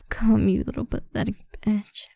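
ordinary talk, nothing marked

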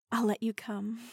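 usual speaking voice

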